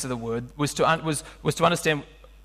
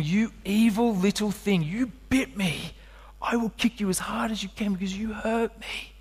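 1 mock inner snarl; 4 semitones higher, 4 dB quieter than usual. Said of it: "I was thinking".

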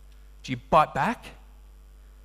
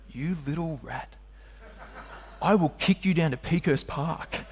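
1 inner grumble; 6 dB quieter than usual. Retold or imitated. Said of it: "you know what I was thinking".

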